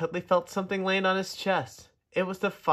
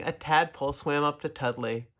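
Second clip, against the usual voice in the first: plain reading voice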